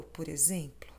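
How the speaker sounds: whisper. Acted. Intimate confession.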